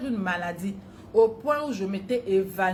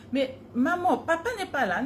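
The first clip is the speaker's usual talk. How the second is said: higher-pitched retold child's question; child asking mother; 8 semitones higher than usual. Child asks maman where papa is, puzzled retold question. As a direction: voice a child querying mum, pitched up.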